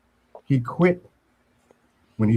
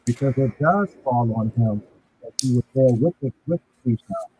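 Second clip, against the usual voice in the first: muffled. Real, not acted. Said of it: "You sound muffled".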